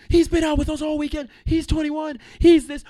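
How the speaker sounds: put-on high, loud protesting girls; mock girls' voices. Wedding party girls arguing with the bouncer. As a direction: squeal it like indignant girls.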